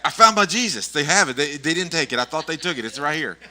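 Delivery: high, loud excited friend on the phone; Bill calling back loud and happy, excited. Retold or imitated. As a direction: burst in with relief that it's found. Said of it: "call me back".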